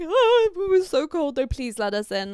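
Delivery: high, loud mock pleading; shivering plea. Two girls begging the bouncer in the cold, mock shivering plea. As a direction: plead in a hammed-up, freezing squeal. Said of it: "like, shivering".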